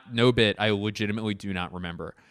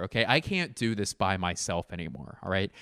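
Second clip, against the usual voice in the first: ordinary delivery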